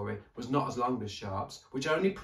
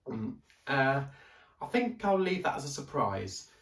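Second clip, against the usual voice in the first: put-on higher, hesitant young boy; boy's voice; 4 semitones higher, 3 dB louder than usual. A narrator's reading. Daniel asking the librarian for a story, higher hesitant boy. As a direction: ask politely, unsure of himself.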